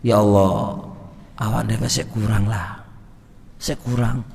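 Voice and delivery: soft, hushed confession; quiet admission of falling short; humble prayer. Shown as a demonstration. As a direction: murmur it humbly to God. Prays humbly to God.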